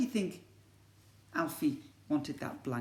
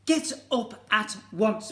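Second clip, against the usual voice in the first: stern mum; 6 semitones higher, 6 dB louder, slower than usual. Acted. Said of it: "said Bernard's mum".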